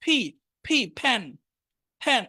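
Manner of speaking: ফ্যান with a sound between প and ফ; English f sound. Shown as a demonstration. Teacher demonstrating fan to Bangla students. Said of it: "আমি একটা উচ্চারণ শুধু বলি".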